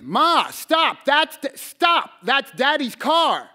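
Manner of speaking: put-on high, loud, desperate child's scream; retold scream. Boy screaming at his mother to stop, loud and high. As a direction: scream at her to stop the car now. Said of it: "So I screamed".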